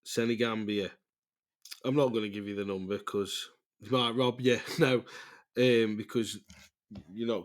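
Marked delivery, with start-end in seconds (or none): none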